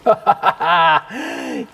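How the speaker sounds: a short amused chuckle; brief scoffing laugh. Acted. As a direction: laugh off his theatrics. Amused at dramatic sorcerer.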